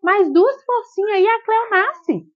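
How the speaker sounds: high, slow, coaxing push from the doctor; doctor cheering the mother on, high and slow; encouraging doctor. Retold or imitated. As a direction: egg her on like a doctor at the birth. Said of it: "Ela foi e falou pra mim assim".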